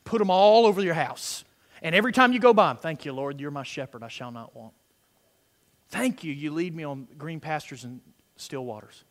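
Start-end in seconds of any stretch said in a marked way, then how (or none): 2.8-9.0 low, soft murmured thanks; quiet personal prayer. Acted. Believer thanking the Lord in passing at home, quiet prayer. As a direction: drop down into private prayer.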